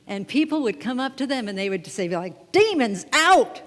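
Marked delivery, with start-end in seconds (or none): 2.5-3.6 loud, slow, higher preacher cry of Demons out!; faith healer. Retold or imitated. Mimicking a TV faith healer casting out demons, loud preacher cry. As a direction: bellow it like a tent-revival healer. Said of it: "like that".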